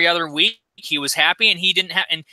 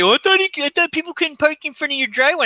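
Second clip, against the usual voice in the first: whiny neighbor mockery; 10 semitones higher than usual. Neighbor complaining next day.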